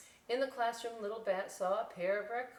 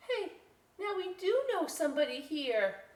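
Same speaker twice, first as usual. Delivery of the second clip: put-on happy kid voice; 10 semitones higher, 5 dB louder than usual. Little Bat cheering up.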